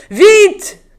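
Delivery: sharp, high, loud yell; one loud high-pitched burst; screamed urging. A narrator's reading. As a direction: yell to hurry them on. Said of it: "hurla Janie".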